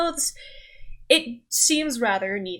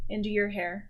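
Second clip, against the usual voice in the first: ordinary delivery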